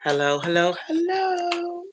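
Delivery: high sing-out hello; calling out hello. Real, not acted. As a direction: call out brightly to see who's there. Calling to unseen viewers.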